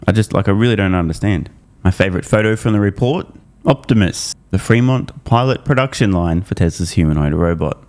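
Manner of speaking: ordinary talk, nothing marked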